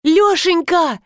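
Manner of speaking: high-pitched woman's call. A narrator's reading. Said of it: "закричал из коридора женский голос".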